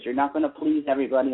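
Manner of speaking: usual speaking voice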